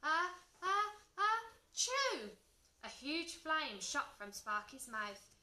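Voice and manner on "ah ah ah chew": high, loud pretend sneeze; exploding sneeze. A narrator's reading. Dragon losing control of a sneeze, loud explosive release. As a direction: gasps building to a big ah-choo. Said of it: "to hold a sneeze".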